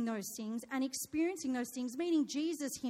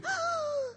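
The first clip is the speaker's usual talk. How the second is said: imitated sobbing gasp. Son about to explain his disaster, mock gasp. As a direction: gulp air like a wailing child. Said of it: "he says to me".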